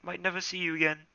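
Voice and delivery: high, quick alarmed plea. Acted. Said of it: "he mewed in alarm".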